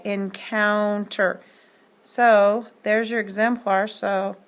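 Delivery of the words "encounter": encounter said slowly after spelling it out; slow word read. Shown as a demonstration. Teacher blending the spelled letters back into a word. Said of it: "E N C O U".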